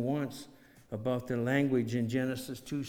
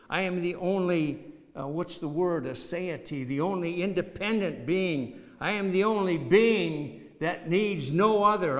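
higher, louder proclaiming of I am; preaching at full force; 5 semitones higher, 6 dB louder than usual. Acted. Preacher voicing God's claims to the congregation, ringing. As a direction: declare it like God speaking.

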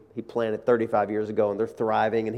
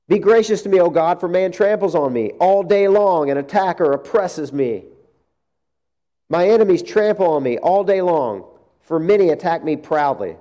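raised, fervent psalm reading; scripture read aloud; 5 semitones higher, 5 dB louder than usual. Acted. Preacher reading the psalm to the congregation, fervent. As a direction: proclaim the psalm with force.

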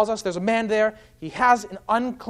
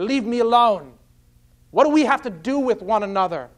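raised, higher defiant voice; moderately raised protest; loud outcry; 3 semitones higher, 4 dB louder than usual. Retold or imitated. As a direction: imitate a demon crying out in confrontation. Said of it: "cries out with a loud voice".

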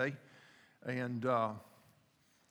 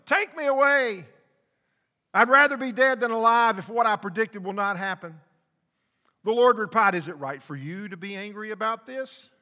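loud, high, fervent preaching; full-throated pulpit fire; preaching at full force; 8 semitones higher, 12 dB louder than usual. Acted. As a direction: preach it hard, driving the point home.

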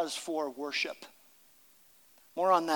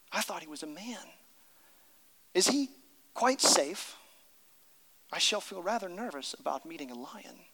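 nervous Susan; 6 dB quieter than usual. Acted. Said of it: "said Susan".

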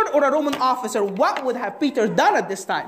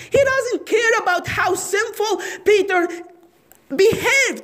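preaching shout; 8 semitones higher than usual. Real, not acted. Preacher stressing God's grace.

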